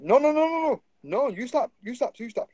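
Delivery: high-pitched mock yell at the kids; old man yelling. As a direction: shout them down like a shopkeeper catching thieves. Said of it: "He's like".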